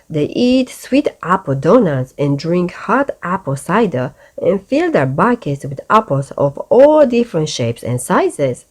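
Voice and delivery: plain reading voice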